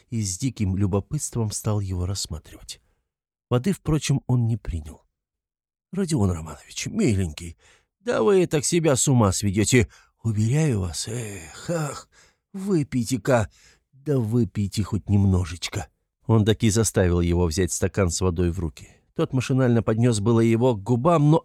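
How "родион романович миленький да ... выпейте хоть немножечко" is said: coaxing, sighing solicitude; fussing, anxious investigator. Acted. Investigator urging Raskolnikov to drink water, anxious coaxing. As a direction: plead tenderly, with little sighs.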